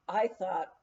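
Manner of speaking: plain reading voice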